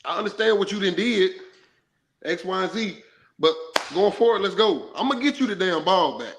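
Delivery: acted firm-but-friendly talk to a player; firm coach talk. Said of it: "that's how you gotta talk to a guy".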